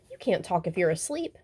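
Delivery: usual speaking voice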